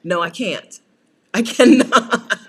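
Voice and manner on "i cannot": laughing refusal. Real, not acted.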